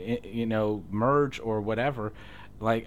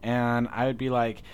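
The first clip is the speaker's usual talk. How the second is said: normal talking voice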